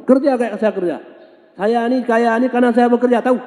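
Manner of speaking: high, loud boastful scolding; arrogant rich man. Acted. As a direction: lord it over the beggar. Rich man lectures the beggar. (orang kaya sombong memarahi musafir, tinggi dan keras)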